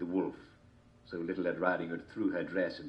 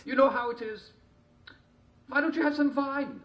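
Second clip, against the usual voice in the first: high wolf voice; 6 dB louder than usual. A narrator's reading.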